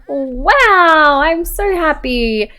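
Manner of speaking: high, drawn-out mock excitement; gushing delight. Friend Krista hears the news, play-acted delight. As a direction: gush with a lifted, stretched-out voice.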